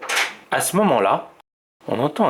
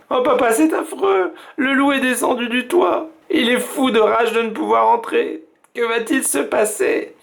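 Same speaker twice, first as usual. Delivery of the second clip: voix d'enfant très aiguë, horrifiée; s'affoler devant les images du livre; enfants effrayés; 11 semitones higher than usual. A narrator's reading. Children frightened by the book. (enfants effrayés, voix aiguë)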